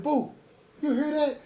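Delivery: high, startled call to his cellmate; waking Boo up with a sudden, alarmed question. Retold or imitated. To his cellmate in the night, high and startled.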